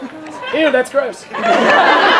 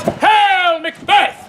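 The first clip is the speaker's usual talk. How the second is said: higher-pitched herald's hail; crying out; 6 semitones higher, much slower than usual. Acted. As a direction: proclaim it like a stage herald. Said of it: "cries out".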